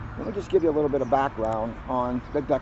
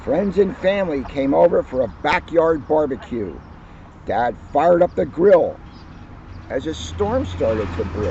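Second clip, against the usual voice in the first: lively, louder picture-book read-aloud; cheerful children's book narration; story reading; 4 semitones higher, 4 dB louder than usual. Acted. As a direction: read it like story time for kids. Reads picture book aloud to audience.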